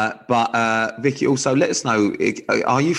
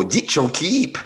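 higher, teasing copy of mock Welsh; mimicked Welsh accent; 4 semitones higher, slower than usual. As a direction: imitate a bad Welsh lilt for fun. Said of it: "listen to the one before".

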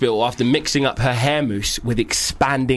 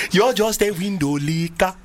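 put-on Nigerian accent; 4 semitones higher, slower than usual. Keeps up the Nigerian accent bit.

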